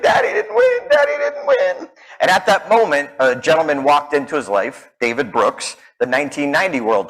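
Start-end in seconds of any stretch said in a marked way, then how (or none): 0.0-1.9 put-on high wailing little boy crying; crying child. Son crying to his dad after the loss, high wail. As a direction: wail it like a heartbroken small son. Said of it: "he's crying".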